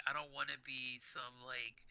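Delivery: usual speaking voice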